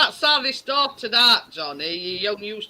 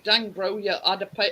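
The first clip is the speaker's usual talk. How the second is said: normal talking voice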